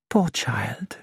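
soft whispered sigh of pity. Acted. Poirot whispering pity after Nick leaves. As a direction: breathe it out with pity.

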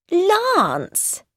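high-pitched cry of joy; delighted cry. A narrator's reading. Wife greeting her returned husband, delighted cry. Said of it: "threw her arms round his neck with delight".

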